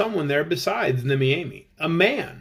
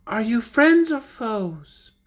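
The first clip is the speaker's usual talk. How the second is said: put-on girl's voice; 7 semitones higher, slower than usual. Wary girl behind door.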